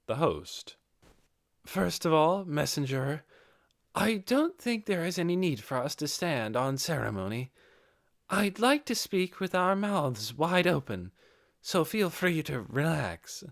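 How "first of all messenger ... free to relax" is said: higher female lizard voice, easing into candour; frank young chief. A narrator's reading. Chief tells the messenger to drop formality, higher and frank. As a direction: invite him to speak openly.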